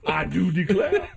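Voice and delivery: high slow Southern drawl. Pretend. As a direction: drawl it out like an old Southern gent. Southern reply again.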